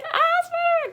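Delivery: very high, loud fan shriek; excited squeal. Retold or imitated. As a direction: shriek it like a superfan. Her reaction as Spider-Man swings in.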